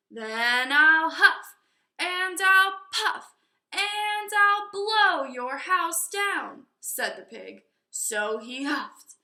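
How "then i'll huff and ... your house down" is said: slow, loud, sing-song pig threat; big bad pig's rising chant; threatening pig. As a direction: chant the threat slowly and loudly.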